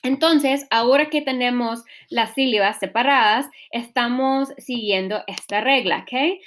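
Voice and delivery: ordinary delivery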